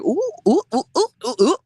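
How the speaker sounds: high, loud shocked oohs. Retold or imitated. Recalling a rabbit hole online, high shocked oohs. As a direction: squeal ooh in mock alarm. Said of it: "I was like".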